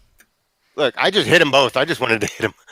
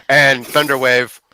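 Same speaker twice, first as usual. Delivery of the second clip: ordinary talk, nothing marked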